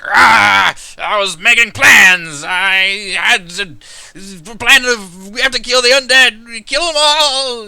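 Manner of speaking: high, loud, frantic and sputtering, words dragged out slowly; a disgraced commander babbling wildly from the mud; sputtering broken commander. Acted. Commander in the mud raving at the captains, shouting high and frantic. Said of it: "just kind of sputtering on the ground".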